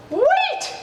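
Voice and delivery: high squeaky cry of a little mouse; Mister Mouse squeaks a warning, very high. Acted. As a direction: squeak it out in alarm. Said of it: "squeaked Mister Mouse".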